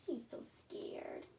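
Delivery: hushed, breathy aside to the listener; whisper. A narrator's reading. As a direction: whisper it like a secret at bedtime. Narrator aside about frightened mouse.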